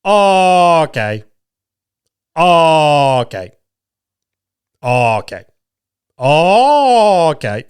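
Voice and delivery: long stretched "oh", then a dropped "kay"; loud, high, drawled OH sinking into okay; drawn-out okays. Retold or imitated. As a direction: imitate an irritating one-word catchphrase, varying each length. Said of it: "in different fucking tones and lengths".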